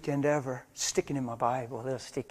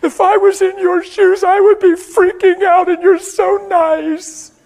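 put-on higher, much louder, slow teenage girl's plea; tearful daughter; 14 dB louder, slower than usual. Teenage daughter crying out to her father, higher and loud. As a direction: cry it out as his overwhelmed daughter. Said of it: "She said".

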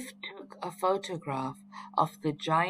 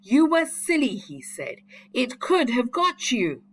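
cross; 7 semitones higher, 6 dB louder than usual. A narrator's reading. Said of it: "Chip was cross with Biff".

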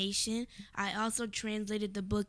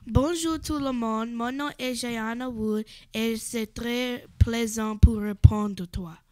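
louder, carefully projected French greeting; French recitation; 10 dB louder than usual. Shown as a demonstration. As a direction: recite your French loudly for the audience. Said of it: "do you wanna speak a little French for us".

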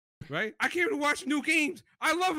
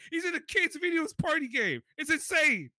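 ordinary delivery

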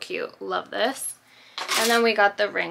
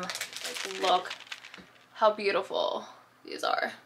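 ordinary delivery

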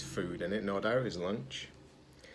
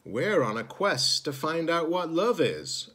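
higher, earnest robot voice; earnest young robot; 7 semitones higher, 3 dB louder than usual. Acted. Zed explaining his quest to the crow, higher and earnest. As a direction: explain it eagerly up high. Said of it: "explained Zed".

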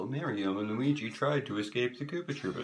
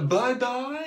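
taunting; 12 semitones higher, 7 dB louder than usual. A narrator's reading. Luigi taunting villains.

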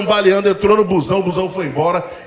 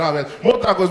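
ordinary delivery

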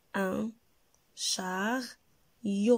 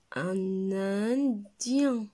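indien drawn out slowly, syllable by syllable; much slower than usual. Shown as a demonstration. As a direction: stretch out indien for the class. (indien lentement, syllabe par syllabe)

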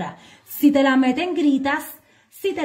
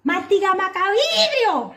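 high mock yell from the crowd; shouted nickname, much higher; heckler's shout; 9 semitones higher than usual. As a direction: call it out from the audience. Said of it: "alguien desde el público grita".

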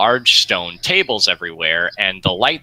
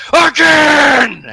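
very high, loud screamed order; orc chef's yell; 9 dB louder than usual. Acted. Orc chef rejecting the goblin's pie, furious screamed order. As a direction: scream the order so it echoes through the chamber. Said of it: "and he says".

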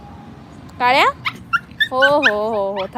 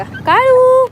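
much higher, louder, slow sing-song call; 12 semitones higher, 9 dB louder, much slower than usual. Real, not acted. Owner calling her dog while filming, high drawn-out call. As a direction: sing the dog's name out.

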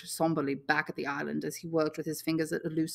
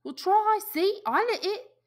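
high and louder, insisting; defensive boy; 5 dB louder, slower than usual. Acted. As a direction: insist it was nothing. Piggy voiced high.

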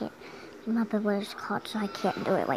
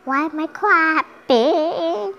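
higher, loud, slow hammed-up wail; mock wail; 6 semitones higher, 8 dB louder, much slower than usual. Kid in mock despair about clapping, slow wail.

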